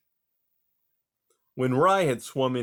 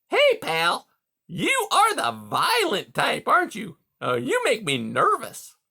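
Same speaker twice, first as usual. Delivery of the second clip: much higher, louder hearty voice; hearty beaver; 10 semitones higher, 5 dB louder than usual. A narrator's reading. Beaver Mister Conrad greeting caged Rye, high and hearty. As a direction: boom out a jovial salesman beaver.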